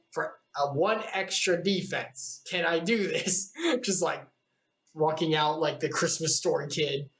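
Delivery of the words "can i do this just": ordinary delivery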